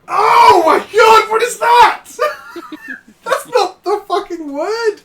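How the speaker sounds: high, loud, slow, laughing disbelief; shocked laughing shout. Real, not acted. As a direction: shriek in laughing horror. Shocked by pronunciation clip.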